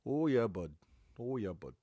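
mock Canadian trucker, starting high then dropping; hammed-up Canadian accent, falling at the end. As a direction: imitate a Canadian trucker's casual agreement. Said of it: "like the Canadian truckers up there".

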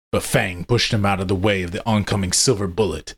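plain reading voice

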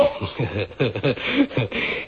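a dismissive, breathy falling laugh; scornful laugh. Acted. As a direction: laugh off the idea of fear.

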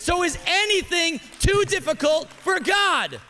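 high, loud rally cry of faith; preached shout. Shown as a demonstration. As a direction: lift it up so the whole room shouts back. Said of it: "Say it again".

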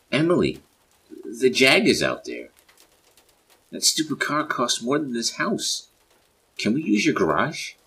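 loud, high-pitched alarm; urgent shouting. A narrator's reading. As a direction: call out frantically about the danger. Acted panic over car in hail.

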